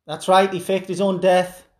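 ordinary delivery

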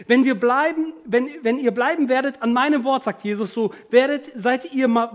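fervent exhortation from the pulpit; full-force preaching. Real, not acted. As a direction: drive each phrase home emphatically.

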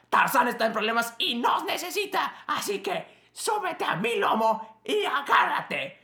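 high, urgent whispered call; rally a friend in a breathless hush; a high, hurried whisper urging a friend to hold on; movie character voice. Acted.

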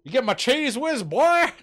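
loud, high movie-quote bark; Blues Brothers quote. Retold or imitated. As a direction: belt it out like the film scene. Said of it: "Blues Brothers?".

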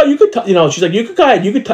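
put-on high gentle coaxing to a toddler. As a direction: coax the little boy to touch. Agent coaxing the child.

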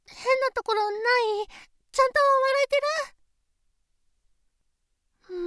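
fretting out loud over her smile. Acted. Nervous check before parents.

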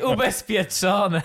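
high, loud, very slow drawn-out exclamation; mock relief. Drivers remembering the wrecked cars are covered, mock relieved cry. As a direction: stretch the word out in staged, gleeful relief. Acting out their relieved afterthought.

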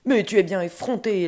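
loud, indignant ogre reproach; annoyed outburst. Acted. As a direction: snap loudly at a cheeky guest. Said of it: "l'elfe s'énerva, grognon".

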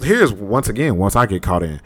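usual speaking voice

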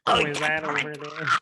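a laughing, put-on alien voice; giggly mock alien. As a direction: talk like a goofy space alien. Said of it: "I'm doing Alien".

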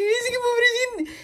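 tearful. Retold or imitated. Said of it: "Tuve que pausar para llorar".

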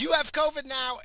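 put-on high, gleeful kid taunt; playful taunt. Son taunting his mother after kissing her, gleefully high. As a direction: tease her as you run off. Son kisses mom and runs.

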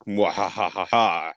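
hammy mwahaha cackle; evil villain laugh. Retold or imitated. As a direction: laugh like a cartoon bad guy. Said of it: "Where it's like".

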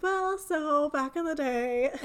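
laughing through the words; cracking up while talking. Real, not acted. Laughing mid-quote about retrograde.

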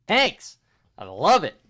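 loud, high, hyped-up yell; excited shout. Real, not acted. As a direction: shout it like a fan. Peak of the tank chant.

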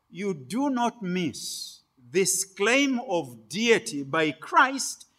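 normal talking voice

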